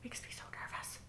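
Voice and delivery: barely voiced, hushed worry; nervous murmur under the breath; nervous whisper. Real, not acted. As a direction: whisper it to yourself, tense. Tense while watching the show.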